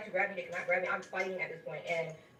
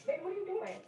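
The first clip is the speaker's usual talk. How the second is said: high, gentle voice of a worried nurse; a nurse finding a patient doing something strange; nurse's voice; 11 semitones higher than usual. Retold or imitated. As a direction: pitch it way up, like a kind nurse checking on a patient. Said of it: "the nurse comes back in and she was like".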